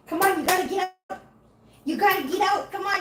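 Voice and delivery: high pleading pet voice; cutesy urging. Real, not acted. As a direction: coax the kitten out, up high. Coaxing cat out of litter box.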